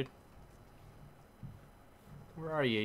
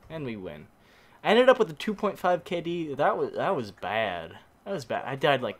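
ordinary delivery

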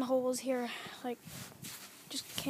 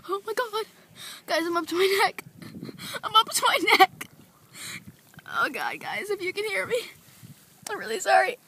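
mock panic; 6 semitones higher than usual. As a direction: pretend to be sinking in panic. Fake drowning panic.

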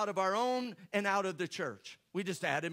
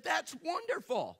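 delighted; 7 semitones higher than usual. Retold or imitated. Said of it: "And I said".

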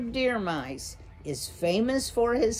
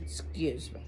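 soft, whispered aside; whispered apology; 4 semitones lower, 8 dB quieter than usual. Real, not acted. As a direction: slip it in under your breath. Reader apologizes for a misreading.

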